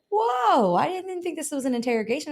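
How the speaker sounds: high, startled client imitation; taken aback. Client balks at barrage of questions.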